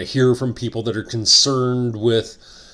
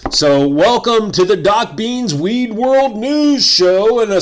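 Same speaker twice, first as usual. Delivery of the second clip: announcer intro; 7 semitones higher, 4 dB louder, slower than usual. Acted. Show intro announcer read.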